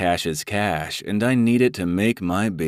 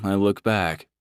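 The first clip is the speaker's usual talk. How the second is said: ordinary delivery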